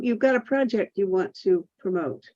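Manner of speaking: ordinary delivery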